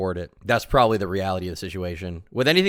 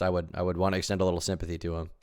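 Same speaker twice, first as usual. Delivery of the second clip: ordinary delivery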